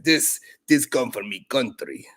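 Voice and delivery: put-on slow broken Russian accent. Woman offering homemade vodka, slow Russian accent. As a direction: lean into a heavy Russian accent. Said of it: "That sounds like Spanish accent".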